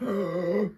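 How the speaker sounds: high gasp for air; imitated gasp. Man recounting crawling through spray, imitated gasp. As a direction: act out struggling to breathe. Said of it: "I'm over here like".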